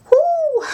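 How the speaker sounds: high, loud giddy whoop; silly whoop. Real, not acted. Calling herself a twit to viewers, whooping. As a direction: let out a daft whoo. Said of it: "I've gone straight into being a twit again".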